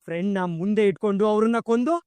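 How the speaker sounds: ordinary delivery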